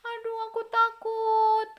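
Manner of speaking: high, slow, sing-song pleading cry; scared child pleading. Acted. Yusuf in the well begging his brothers, scared and high. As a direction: beg the big brothers, terrified. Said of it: "Yusuf ngomong begitu ya".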